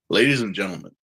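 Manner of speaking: low, formal announcer narration; narrator voice. Shown as a demonstration. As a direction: read it like a grave disclaimer announcer. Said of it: "let me try to do the narrator voice".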